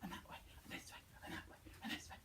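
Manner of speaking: breathy whisper. Shown as a demonstration. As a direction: whisper it softly. Whispered face exercise.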